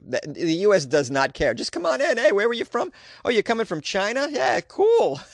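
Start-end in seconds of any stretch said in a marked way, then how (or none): 1.5-5.2 high-pitched, breezy mock-friendly greeter; over-eager welcomer. Careless U S greeter to travelers from abroad, mock over-friendly. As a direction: wave them through in a high, chummy voice.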